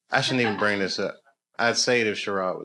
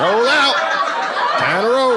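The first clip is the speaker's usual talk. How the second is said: laughing cruise; 11 semitones higher, 8 dB louder than usual. Acted. Acting out the lazy driving.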